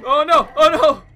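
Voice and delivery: loud panicked cry; being chased. Real, not acted. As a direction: yell in alarm as it catches you. Caught by the chasing dog.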